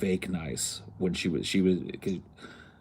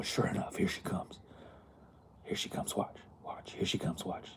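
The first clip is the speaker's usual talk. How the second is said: soft, hushed whisper; conspiratorial whisper; 10 dB quieter than usual. Retold or imitated. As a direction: whisper to a coworker as the customer approaches. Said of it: "quoting Nico".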